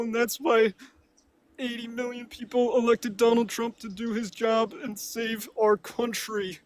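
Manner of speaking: high, wailing mock sob over the words; exaggerated fake weeping; fake crying. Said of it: "Can you stop fake crying".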